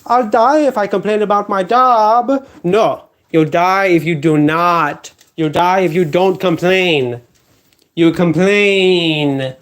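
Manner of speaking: usual speaking voice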